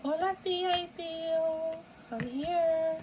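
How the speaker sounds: very high sing-song greeting; mock cheery falsetto greeting; playful hello. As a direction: call out in a high, drawn-out put-on voice. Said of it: "and it's like".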